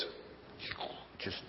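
breathy whispered aside, no voice at all; secretive whisper mid-sentence. Real, not acted. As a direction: drop to a hushed whisper for two words. Aside about pride growing like steroids.

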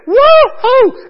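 very high, loud "woo-hoo"; joyful whoop. Acted. Preacher demonstrates the unborn baby's joy, exultant whoop. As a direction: let out an exultant shout of joy. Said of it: "It was this joy".

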